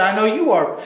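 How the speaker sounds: playground taunt. Retold or imitated. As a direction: throw it back like a child.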